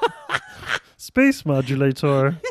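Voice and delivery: slow, lightly voiced cartoon Martian; Marvin the Martian voice. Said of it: "your friend".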